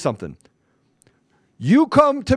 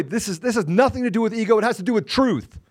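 ordinary talk

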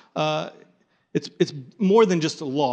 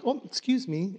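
prim polite voice; 6 semitones higher, 5 dB quieter than usual. Shown as a demonstration. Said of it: "It's not like".

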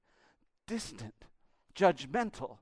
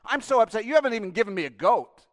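loud, fast, sulky whining; elder son complaining to father; whiny complaint; 7 semitones higher, 11 dB louder, faster than usual. Acted. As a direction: pout through it. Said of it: "That's what he's saying".

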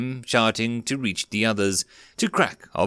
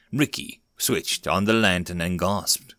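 ordinary delivery

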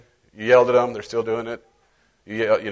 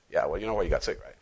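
low, soft, sly devil voice; devil's voice; 10 semitones lower, 9 dB quieter, faster than usual. Acted. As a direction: murmur it like the accusing devil. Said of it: "the devil will say".

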